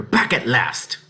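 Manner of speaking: curt snap; snapped. Acted. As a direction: bite at her husband. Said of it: "she snapped".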